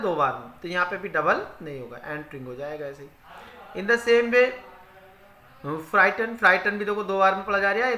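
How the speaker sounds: usual speaking voice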